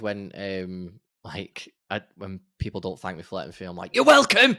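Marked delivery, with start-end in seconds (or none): 4.0-4.6 high loud sarcastic yell; sarcastic shout. Retold or imitated. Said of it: "shouting it from the top of my lungs".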